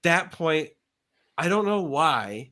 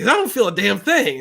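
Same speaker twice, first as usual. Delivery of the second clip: loud, higher-pitched mock complaint; retold indignation; 5 semitones higher, 7 dB louder than usual. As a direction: protest it loudly, acted-out frustration. Retold complaint that weed fails.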